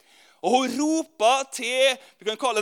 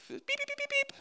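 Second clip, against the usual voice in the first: chick peeps; 10 dB quieter than usual. Retold or imitated. Preacher imitates newborn chicks.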